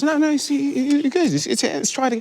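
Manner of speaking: high, fumbled British impression; stammering mock British director; British accent. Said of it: "he's doing a British accent".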